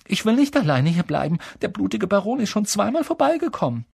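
frightened pleading. A narrator's reading. Neville dreads staying alone near the Baron, pleading. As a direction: beg in a high frightened voice. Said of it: "rief Neville".

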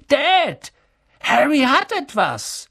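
put-on high-pitched boyish alarm; excited tattling. A narrator's reading. Dudley tells on Harry to his father, excited and high. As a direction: call it out high to tell on someone.

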